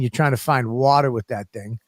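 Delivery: ordinary talk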